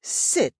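"Sit" with a hard, separated T; dog command "Sit", T released as its own beat; pronounced T. Retold or imitated. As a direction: pop the T at the end of "Sit". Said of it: "great effort to pronounce the T".

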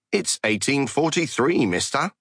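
high put-on Cockney; Cockney accent. A narrator's reading. Kuntz answering what year England is in, high Cockney. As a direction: pipe up in mock Cockney. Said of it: "in a Cockney accent".

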